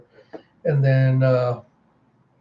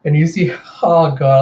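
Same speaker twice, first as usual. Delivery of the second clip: usual speaking voice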